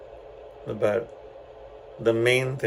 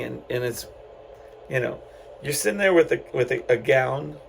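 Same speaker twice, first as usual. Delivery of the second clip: ordinary delivery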